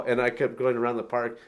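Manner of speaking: normal talking voice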